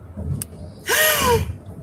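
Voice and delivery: sharp high gasp of surprise; startled gasp; surprised gasp. Real, not acted. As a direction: gasp at a sudden friend sighting. Surprised at seeing friend Lydia.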